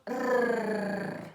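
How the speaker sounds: long rolled R, held out alone; demonstrated R sound. Shown as a demonstration. Teacher showing learners the sound of R, drawn-out trill. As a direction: hold a buzzing trilled R. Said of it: "o som do R".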